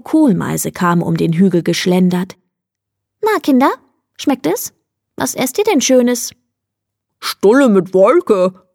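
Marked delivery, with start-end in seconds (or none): 3.2-6.3 higher, singsong grown-up voice; friendly pastor. A narrator's reading. Pastor Titmouse asks the children about their snack, high and singsong. 7.2-8.7 slow, muffled call through food; mouth full. A narrator's reading. Fox answers the pastor while eating, calling with mouth full. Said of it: "rief Fuchs mit vollen Backen".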